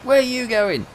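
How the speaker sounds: high, loud shouted question; mate shouting in the lift again; loud call. Retold or imitated. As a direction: call after him across the lift. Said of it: "replaying the lift moment".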